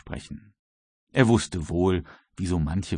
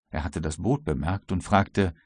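usual speaking voice

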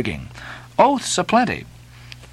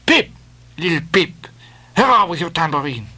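higher, lively sailor calling for a jig; French sailor; 9 semitones higher than usual. Acted. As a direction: urge the crew to dance. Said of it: "French sailor".

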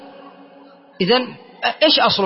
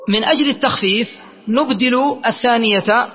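ordinary talk, nothing marked

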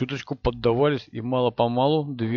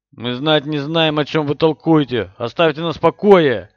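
defiant shout; 3 semitones higher, 7 dB louder than usual. Acted. Frightened Rand refuses through door.

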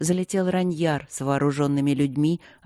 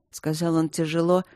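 plain reading voice

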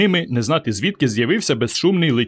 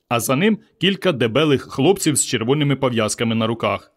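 plain reading voice

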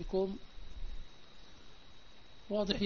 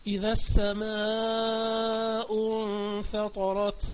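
Quran recitation. Acted. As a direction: stretch the verse out prayerfully. Repeating the verse recitation.

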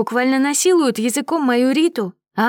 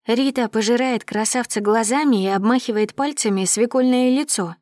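ordinary delivery

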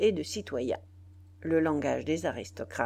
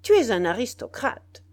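loud, higher accusing snap; impatient accusation; 4 semitones higher, 8 dB louder than usual. A narrator's reading. Biassou accusing the citizen, loud and impatient. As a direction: hurl the charge at him. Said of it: "d'un air impatienté".

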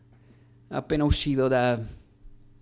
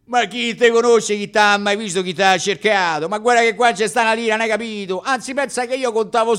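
angry boss; 7 semitones higher, 6 dB louder than usual. Retold or imitated. Boss scolding the unpaid actor.

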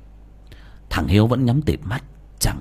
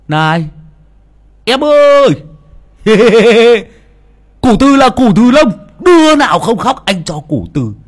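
high, loud leering crazy voice with laughs; deranged flasher; 13 semitones higher, 9 dB louder than usual. Acted. Mad Trung calls out lewdly to village women, high and loud. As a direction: cackle and leer at the girls.

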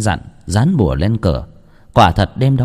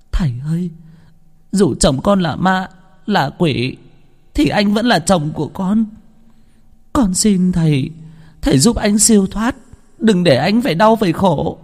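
put-on much higher, tearful begging young widow; pleading through sobs; 9 semitones higher than usual. Đào begging the shaman to save her husband, high tearful plea. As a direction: cry out a desperate plea for your husband. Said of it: "ôm lấy bà cụ mà nức nở".

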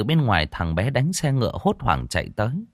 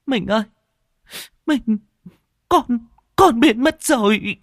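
high, broken sobbing, slowing at the end; bursting into sobs; 14 semitones higher, 4 dB louder than usual. A narrator's reading. Wife sobs to her husband that their child vanished, high and broken. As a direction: choke out the words through tears. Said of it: "Thị òa lên nức nở".

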